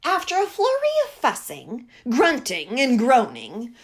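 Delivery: usual speaking voice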